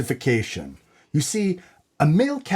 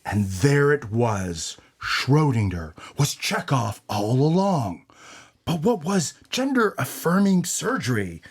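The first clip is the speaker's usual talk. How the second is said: noir detective narration; 3 semitones lower than usual. Acted.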